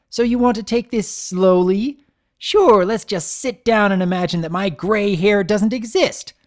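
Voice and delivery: loud, high, biting sarcasm; mocking him while boiling over; sarcastic fury. Twilight's sarcastic furious tirade.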